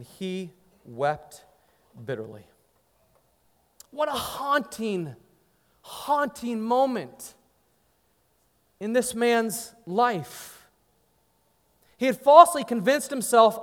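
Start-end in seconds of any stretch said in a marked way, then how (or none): 0.0-2.5 sorrowful narration. A narrator's reading. Narrating Peter's weeping. 3.9-10.7 slow and solemn. Real, not acted. Preacher reflects on Peter's failure.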